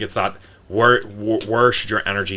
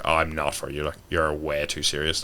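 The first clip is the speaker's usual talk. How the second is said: low, soft, slower brush-off; offhand dismissal; 5 semitones lower, 5 dB quieter than usual. Retold or imitated. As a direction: let them down casually. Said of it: "I'm like".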